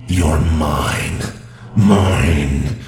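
loud booming demon voice. Acted. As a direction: roar it out like a demon. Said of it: "You wanna hear my demon voice?".

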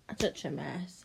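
mocking laugh. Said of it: "you're really whimpering now".

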